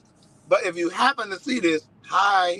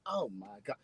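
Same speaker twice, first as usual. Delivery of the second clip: much softer, lower embarrassed whisper; mortified mutter; 5 semitones lower, 16 dB quieter than usual. Retold or imitated. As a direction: say it under your breath, mortified. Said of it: "I'm like".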